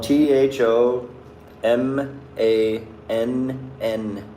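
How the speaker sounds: slow letter-by-letter spelling T H O M A N N; dictating a name's spelling. Shown as a demonstration. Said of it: "It's spelled".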